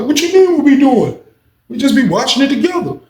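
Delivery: high, put-on clueless inmate; naive cellmate voice. Naive cellmate defending watching TV together, high voice. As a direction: play him innocent and oblivious.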